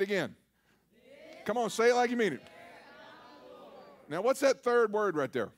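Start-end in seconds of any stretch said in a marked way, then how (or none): none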